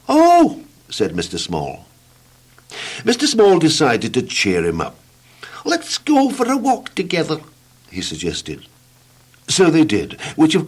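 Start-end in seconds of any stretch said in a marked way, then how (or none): 0.1-0.6 a tiny high squeak of a voice. Acted. Mister Small speaks. 5.6-7.4 a tiny high squeak of a voice. Acted. Mister Small suggests.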